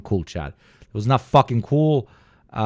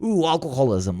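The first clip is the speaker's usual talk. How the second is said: mock teasing; 7 semitones higher than usual. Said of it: "we can make fun of each other".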